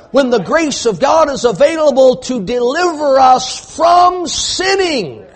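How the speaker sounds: rising fiery sermon shout; preaching at full force. Acted. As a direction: thunder it at the congregation. Preacher climaxing on grace.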